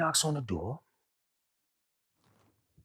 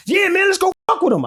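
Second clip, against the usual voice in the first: excited; 9 dB louder than usual. Retold or imitated. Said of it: "So he like".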